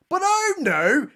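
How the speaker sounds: high, slow mock gasp of alarm; theatrical oh no. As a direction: ham up the shock. Mock horror at new predator.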